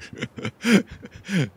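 quick amused chuckle; short laugh. Real, not acted. Laughs at own wrecked helmet.

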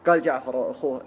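ordinary delivery

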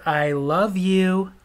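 wife repeats it very slowly; slow drawn out. Retold or imitated. Wife repeating herself, slow and drawn out. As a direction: stretch each word out. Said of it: "I said".

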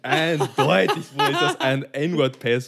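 laughing incredulity. Real, not acted. Joking with friends about a slang word, laughing incredulously. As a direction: stretch it slowly while laughing. Cracking up at own joke.

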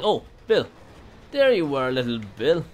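gleeful taunt. Acted. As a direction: gloat loudly at the found victim. Killer finds a hiding survivor.

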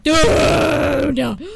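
long, loud yell; drawn-out alarmed holler; startled shout. Real, not acted. Startled during horror game.

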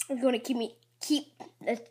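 ordinary delivery

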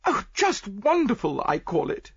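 high, loud society matron; gushing rich lady. Acted. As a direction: gush like a proud wealthy wife. Mrs Opalson gushes.

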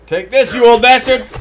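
loud, high yell at a foe; mock battle cry. Gamer taunting an enemy mid-fight, loud battle yell. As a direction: shout it like you're landing a blow.

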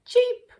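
high squeaky cheep; loud bird cheep. A narrator's reading. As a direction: chirp like a baby bird.

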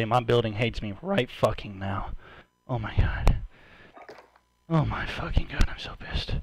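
quiet breathy venting; hushed frustration. Real, not acted. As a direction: keep it down for the building but still fuming. Neighbours hear him, quieter.

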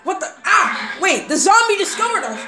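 high, loud, excited yelling; panicked alarm. Pretend. Players discover villagers inside, panicked yelling.

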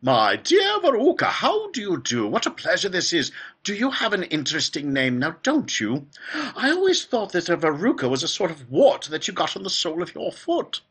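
high, fluty, excitable greeting; Willy Wonka. A narrator's reading. Factory owner greeting the next girl, high and effusive. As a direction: chatter loudly in a piping voice with a giggle. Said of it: "His voice was high and fluty".

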